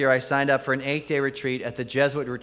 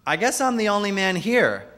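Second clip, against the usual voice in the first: higher-pitched mock blurt; awkward retold outburst, pitched up; 7 semitones higher than usual. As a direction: blurt it out, imitating your own awkward moment. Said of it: "I blurted out".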